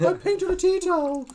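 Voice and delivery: teasing friend in a high silly voice; mock squeaky show-off. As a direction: play a smug oddball boasting. Same mock voice.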